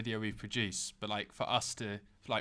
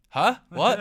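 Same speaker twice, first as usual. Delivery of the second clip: loud baffled huh what, higher; mock confusion shouted; confused shout; 9 semitones higher, 9 dB louder than usual. Acting confused louder.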